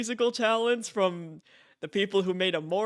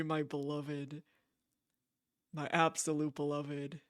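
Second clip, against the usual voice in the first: tender swoon; 3 semitones lower, 8 dB quieter than usual. Real, not acted. Streamer to chat about her favourite character, softly swooning. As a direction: murmur it like a love confession.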